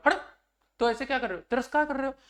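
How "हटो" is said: scolding. Shown as a demonstration. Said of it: "ऐसे डांटते अपन".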